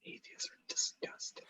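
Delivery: breathy mock-serious whisper; whispered aside. Real, not acted. Co-host whispering a jab at atheists. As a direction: whisper it under your breath.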